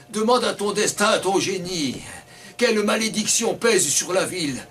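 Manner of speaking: ordinary delivery, nothing marked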